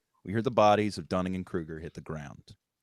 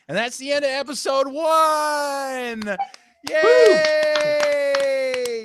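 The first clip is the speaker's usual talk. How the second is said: excited shout; 12 dB louder, much slower than usual. Real, not acted. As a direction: shout it out high and loud, slowly. Celebrating end of episode.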